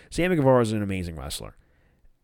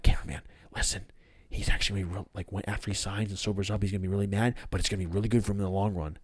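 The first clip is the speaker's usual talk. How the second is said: low, soft, quick aside; aside to camera; 3 semitones lower, 5 dB quieter, much faster than usual. Retold or imitated. Matt breaking the fourth wall to the viewers, hushed aside. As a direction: confide it to the audience. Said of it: "Matt breaks the fourth wall".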